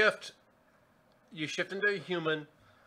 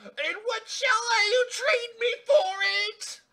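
shrieking Red Queen; 8 dB louder than usual. Acted. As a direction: wail it like an outraged queen. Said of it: "the queen goes".

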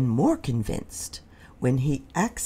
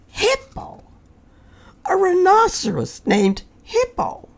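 amazed disbelief; 6 dB louder than usual. Acted. Fluffy amazed at the name.

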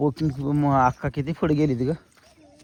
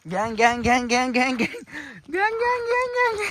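high sing-song calling to an animal; 8 semitones higher than usual. Real, not acted. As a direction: coax the animal along in a high voice.

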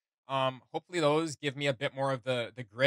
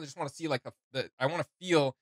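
normal talking voice

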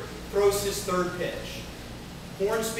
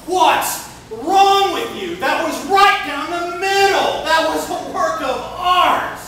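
higher, louder, slow indignant protest; arguing pitcher; 9 semitones higher, 9 dB louder, slower than usual. Retold or imitated. Rookie pitcher arguing with the umpire, indignant shout. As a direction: argue the call, outraged and loud. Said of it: "the kid argues with him".

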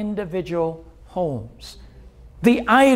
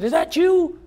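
surprised question, pitched well up; a startled, high Is that you; 8 semitones higher than usual. Retold or imitated. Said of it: "So he said".

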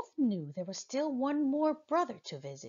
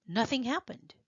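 usual speaking voice

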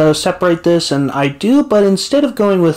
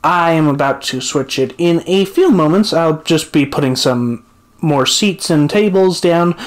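ordinary delivery

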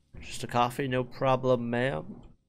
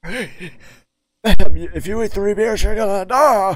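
loud high yell; high, far-off shout; 10 semitones higher, 21 dB louder, slower than usual. Acted. Yelling warning at customer.

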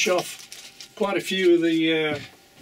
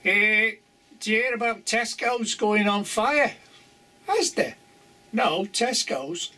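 higher-pitched Scouse joke voices; put-on Scouse accent; 4 semitones higher than usual. Telling the supermarket gag to viewers, in a Scouse accent. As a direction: play both sides of a gag in Scouse. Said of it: "you gotta do it in a Scouse accent".

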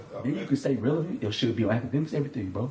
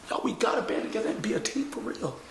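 usual speaking voice

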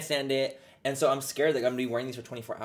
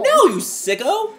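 mock outrage; 11 dB louder, slower than usual. Real, not acted. Joking outrage.

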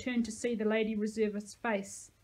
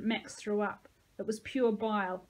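plain reading voice

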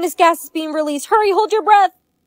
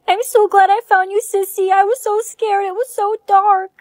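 high little sister voice; relieved little kid; little girl voice; 8 semitones higher than usual. Acted.

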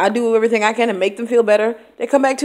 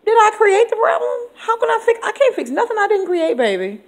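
much higher, incredulous pushback; indignant retort; 11 semitones higher than usual. Retold or imitated. Teacher answering blaming students, high indignant retort.

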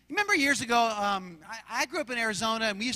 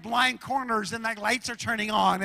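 ordinary talk

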